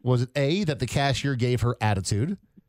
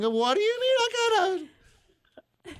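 very high, drawn-out mock disbelief; incredulous; slower than usual. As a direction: imitate his voice climbing way up in disbelief. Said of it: "I love how incredulous he got".

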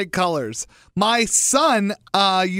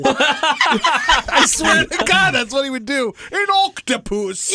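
laughing hard while talking; words squeezed out through laughter; 6 semitones higher, 3 dB louder, slower than usual. Real, not acted.

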